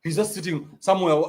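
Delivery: ordinary talk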